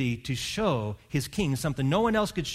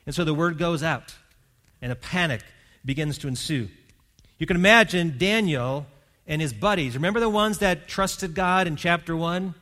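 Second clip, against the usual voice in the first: usual speaking voice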